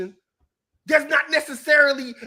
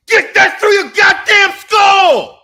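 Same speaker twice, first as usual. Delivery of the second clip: slow, loud, much higher angry yell; furious shout; 7 semitones higher, 11 dB louder, slower than usual. Real, not acted. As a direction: hammer it into their skull, slowly. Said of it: "Yes, I'm snapping on you".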